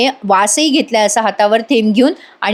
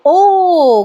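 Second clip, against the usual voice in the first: long high "oh", falling; theatrical realisation; 7 semitones higher than usual. Retold or imitated. Vijay hearing the witness heard a sound, theatrical realisation. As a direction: draw out an exaggerated ooh. Mock lawyer reacts to the testimony.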